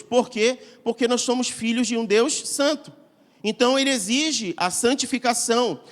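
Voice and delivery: fervent sermon climax; preaching at full force. Acted. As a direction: drive the point home to the congregation. Sermon climax, rising intensity.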